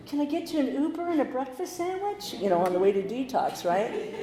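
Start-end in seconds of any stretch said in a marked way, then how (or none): 0.1-2.3 much higher, sugary offer; higher sing-song mock sweetness; mock coddling. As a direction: parody overly nice helper. Mocking modern coddling.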